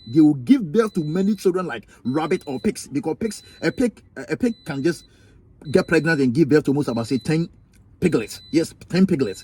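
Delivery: ordinary delivery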